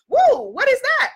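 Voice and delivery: a high startled whoa; startled city self. Retold or imitated. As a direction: jump at the strange sound. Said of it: "city self says".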